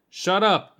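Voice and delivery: loud, high yelled command. A narrator's reading. Narrator screams back at the creature on screen, yelling. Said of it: "I yelled".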